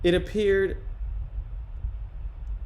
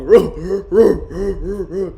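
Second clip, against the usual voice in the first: loud rolling woofs; rapid big-dog "roo roo" barks; playful dog barking; 6 dB louder than usual. Pretend. Streamer playing the big dog, loud playful barking.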